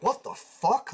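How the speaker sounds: high-pitched mock shock; incredulous retold exclamation; retold startled disbelief. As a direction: imitate someone startled awake, pitch jumping up. Retells waking to intruders in room.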